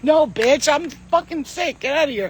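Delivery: mock rebuff. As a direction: shoo them out with sass. Mock-rebuffing nurses after surgery.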